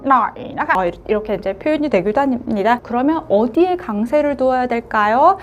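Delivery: ordinary talk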